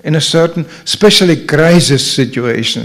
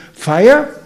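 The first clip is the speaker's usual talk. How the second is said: higher, rising query on "Fire?"; questioning, unalarmed; 7 semitones higher than usual. Acted. Merchant type hearing of a fire, questioning. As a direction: ask it, don't shout it. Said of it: "few who will think".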